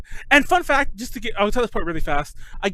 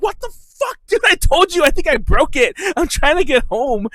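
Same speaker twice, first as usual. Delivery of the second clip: high, loud exasperated protest; re-enacted angry complaint, pitched up; retold indignant outburst; 8 semitones higher, 4 dB louder than usual. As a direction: protest as if someone just hurt your broken foot. Retold outburst at airport searcher.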